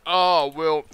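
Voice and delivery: drawn-out, put-on 'oh' of a dopey dude; imitated customer. Customer reacting to the clerk's fix, loud imitation. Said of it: "this dude is talking like this".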